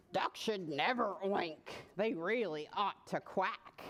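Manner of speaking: lightly voiced low pig; sputtering pig. A narrator's reading. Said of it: "the pig just sputtered back".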